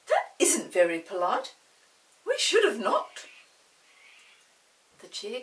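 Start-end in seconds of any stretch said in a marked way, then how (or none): 0.1-3.3 louder, fretful, rising high; worried Molly. Acted. Molly worrying they should have knocked, loud and fretful. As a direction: fret about manners. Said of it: "said Molly in alarm".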